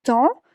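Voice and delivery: temps dicho "tan", sin P ni S final; vocal nasal sola en temps. Shown as a demonstration. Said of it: "no se pronuncia la P, tampoco la S". (temps pronunciado "tã", sin consonante final)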